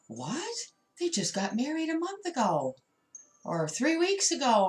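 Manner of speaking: high, incredulous gossip voice; mock shocked gossip. Tarot reader imitating gossiping onlookers, mock shocked and high. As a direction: play the shocked onlooker who can't believe it. Imagined gossip of other people.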